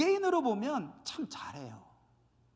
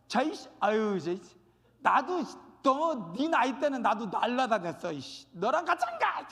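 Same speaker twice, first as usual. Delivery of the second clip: impatient grumbling. Acted. Acting impatient person ahead of others. (짜증스럽게 투덜대며 언성을 높여)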